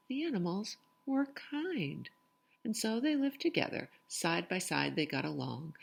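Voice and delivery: lively, sing-song storytelling voice; picture book read-aloud. Acted. Reading a rhyming picture book aloud to children, lively sing-song. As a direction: bounce the rhymes for young listeners.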